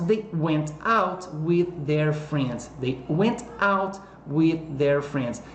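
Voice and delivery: teacher modelling the answer slowly for the class; slow careful answer. Shown as a demonstration. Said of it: "slow model answer".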